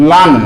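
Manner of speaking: লান said LAAN with final n; modelling for students; sounded out. Shown as a demonstration. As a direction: close লান on a clear n. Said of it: "লাম দু জাবার".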